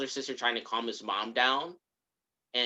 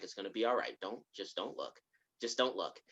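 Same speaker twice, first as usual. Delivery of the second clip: very soft, low reassurance; comforting, retold; 5 semitones lower, 10 dB quieter than usual. Grandmother as he cries in her arms, retold soft comforting. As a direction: hush a crying child gently. Voicing grandmother soothing crying boy.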